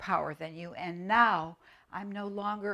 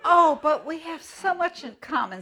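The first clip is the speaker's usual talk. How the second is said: put-on little girl pleading; 9 semitones higher, 8 dB louder than usual.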